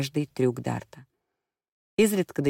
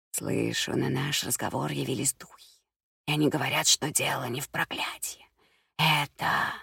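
таинственная гадалка; 4 dB quieter than usual. A narrator's reading. Гадалка вещает о духах.

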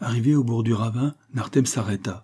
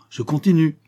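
higher, louder, pleased to show off; triumphant; 7 semitones higher, 5 dB louder than usual. A narrator's reading. Husband shows his wife the trick, triumphant. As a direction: press on triumphantly, voice lifted.

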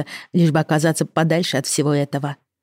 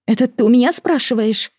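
indignant retort; 8 semitones higher than usual. Acted.

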